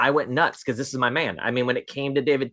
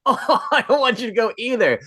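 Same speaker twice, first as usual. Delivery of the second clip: laughing; 10 semitones higher, 4 dB louder than usual. Retold or imitated. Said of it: "I was like".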